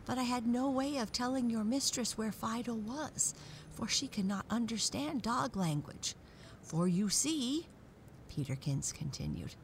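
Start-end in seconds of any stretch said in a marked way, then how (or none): 0.1-7.7 high-pitched talking dog; worried dog character. A narrator's reading. Peterkins explaining to the dolls about Fido, high and worried.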